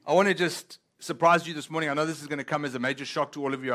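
ordinary talk, nothing marked

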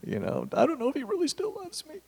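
high, soft, wavering voice of doubt; mock doubting whine. Mimicking a believer in pain, high and soft. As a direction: voice a hurting believer losing faith. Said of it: "and we're like".

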